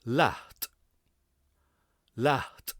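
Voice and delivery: Laat with breath running into the final t; Laat, aspirated ending; breathy Laat. Shown as a demonstration. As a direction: let air slide into the T of Laat. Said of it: "Listen how that should sound".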